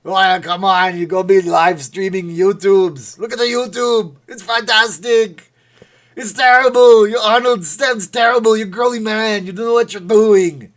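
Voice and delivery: loud, high hammed-up Austrian yelling; Arnold. Streamer ranting at viewers about YouTube, yelled Arnold impression. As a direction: bellow like an overexcited action star. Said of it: "they love Arnold".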